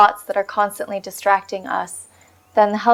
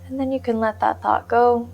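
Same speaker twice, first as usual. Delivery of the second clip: soft, unhurried meditation voice with long pauses; guided meditation; 6 dB quieter than usual. Real, not acted. Meditation teacher settling listeners onto the breath, calm guided-meditation voice. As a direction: gently guide them into stillness, leaving space between phrases.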